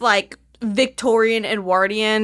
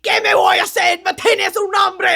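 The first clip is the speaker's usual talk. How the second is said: loud, high pirate-ish Spanish; 7 semitones higher, 4 dB louder than usual. Pretend. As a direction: shout it like a hammy pirate. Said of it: "sounding like a pirate".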